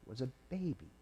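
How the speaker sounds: ordinary delivery, nothing marked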